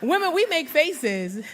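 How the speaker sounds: normal talking voice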